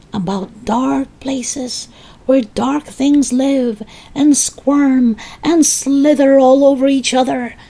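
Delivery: high, slow sinister witch; menacing witch. Acted. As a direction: relish the creepy words.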